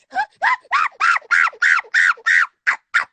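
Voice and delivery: high screaming laugh, out of control; loud shrieking cackle. Real, not acted. Laughing at a viewer's big nose, a loud shrieking cackle. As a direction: cackle shrill and loud at the joke. Cackling at her own roast.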